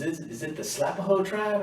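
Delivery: high, sing-song mock sergeant; mock drill sergeant. Drill sergeant taunting recruit in line, high mock friendliness. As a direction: tease him in a high fake-friendly voice. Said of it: "He goes".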